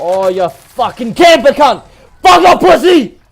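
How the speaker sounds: screaming in a rage at a camper; furious screaming. Real, not acted. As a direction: shriek it at full blast, pitch way up. Raging at a camper.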